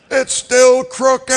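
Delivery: hammed-up dad yelling; mock furious yell. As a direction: drag it out like a furious father. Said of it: "I'm like".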